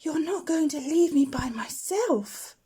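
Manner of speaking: high, slow, whispery little owl pleading; a frightened baby owl begging not to be left; small owlet voice. A narrator's reading. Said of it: "said Plop".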